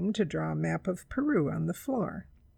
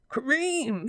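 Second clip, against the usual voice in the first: higher-pitched disgusted exclamation; bitter; 6 semitones higher than usual. A narrator's reading. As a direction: spit it out bitterly. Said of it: "he said bitterly".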